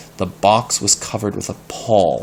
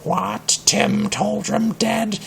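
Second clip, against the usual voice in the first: high, slow, otherworldly cat voice; a strange cat finding its voice, slow; talking cat; 13 semitones higher, slower than usual. Acted. Tom the cat hears the news, high and eerie. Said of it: "and then Tom spoke".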